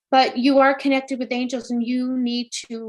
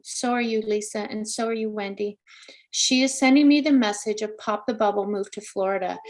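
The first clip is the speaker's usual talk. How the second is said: ordinary talk, nothing marked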